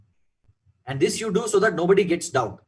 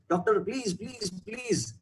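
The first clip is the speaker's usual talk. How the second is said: softer and softer, fading into pleading; begging; 3 semitones higher, 6 dB quieter, slower than usual. Acted. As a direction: beg the doctor, voice sinking away. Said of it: "And she starts begging".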